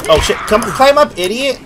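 high, loud panicked shout; yelling. Real, not acted. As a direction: yell it up at a teammate.

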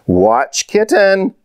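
high, drawn-out call of caution; warning. A narrator's reading. Mama minding the girls' kitten near the bush, warning. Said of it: "warned Mama".

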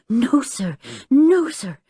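a touch of panting after a struggle; out of breath. A narrator's reading. Maid after struggling with the professor, panting. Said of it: "panted Jenny, out of breath".

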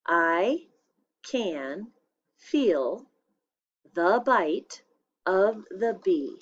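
I can feel the bite of the bee with a pause after each word. Shown as a demonstration. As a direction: dictate it one word at a time. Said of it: "I'll say it one more time".

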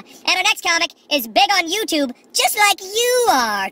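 high, slow emcee intro; mock club emcee announcing; announcer voice. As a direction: introduce the next act grandly. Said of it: "he's like".

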